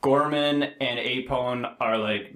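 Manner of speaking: usual speaking voice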